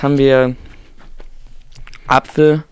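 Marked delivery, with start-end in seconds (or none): none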